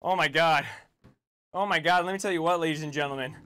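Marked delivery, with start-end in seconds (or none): none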